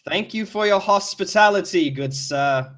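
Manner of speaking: loud, overly polite sarcasm; passive-aggressive. Acted. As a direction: thank him with biting politeness. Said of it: "in sort of a, a passive-aggressive voice".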